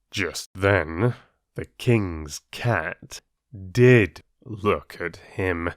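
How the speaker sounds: plain reading voice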